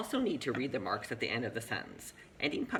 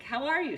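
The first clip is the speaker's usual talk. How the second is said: "How are you" pitched high and louder as a question; question intonation; 13 semitones higher, 5 dB louder than usual. Shown as a demonstration. Teacher echoing the pupil's "How are you", high question tune. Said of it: "See how the end of your voice went up".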